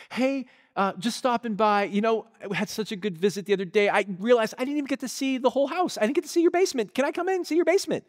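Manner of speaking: high, fast, strangely bright voice; eerie eager girl. Retold or imitated. Girl at the door pushing to see the basement, high and rushed. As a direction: rattle it off high and quick like something's off.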